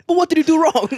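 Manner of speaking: put-on insolent child. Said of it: "It's like".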